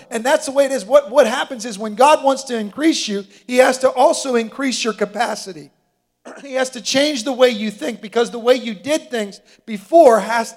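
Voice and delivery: loud, high, driving sermon voice; full-force preaching. Acted. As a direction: preach it hard to the room.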